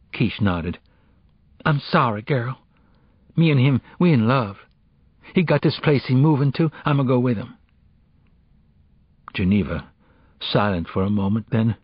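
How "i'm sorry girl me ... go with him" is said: put-on higher-pitched teenage girl, confessing; a hint of an apologetic girl; lightly voiced apologetic friend. Friend admitting she is in love, mildly apologetic.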